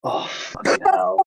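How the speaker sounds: slow, breathless groaning oh fucking hell; groaning exasperation. Real, not acted. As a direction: groan at the terrible joke. Reaction to pun groan.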